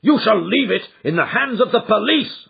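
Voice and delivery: high, loud, half-mad threat; grief-stricken rage. Acted. Father half mad with grief threatening his son. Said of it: "I cried, half mad with grief and rage".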